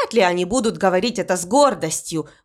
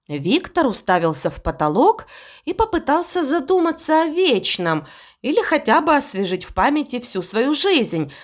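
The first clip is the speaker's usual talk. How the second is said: ordinary delivery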